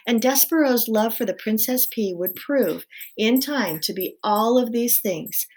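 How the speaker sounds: plain reading voice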